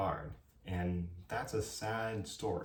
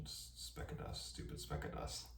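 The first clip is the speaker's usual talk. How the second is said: very soft, voiceless grumble to yourself; whispered aside; 12 dB quieter than usual. Real, not acted. Vlogger distracted by a speck of dust, whispered aside. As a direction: whisper it under your breath.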